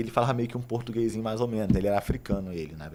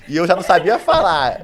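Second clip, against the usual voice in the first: laughing; 8 semitones higher, 18 dB louder than usual. Real, not acted. Laughing at his own poor English.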